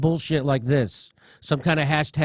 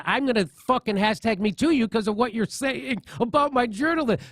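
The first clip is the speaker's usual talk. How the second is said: higher whiny imitation of the accuser; mocking voice; 7 semitones higher than usual. Mocking his colleague's threat against him, higher whine. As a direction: sneer at her threat in a higher voice. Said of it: "she's literally".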